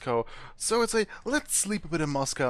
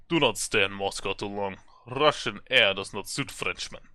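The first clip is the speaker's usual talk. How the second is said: put-on stern Russian police accent, pitched lower; 5 semitones lower than usual. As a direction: lean into a thick Russian officer's accent. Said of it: "Russian accent".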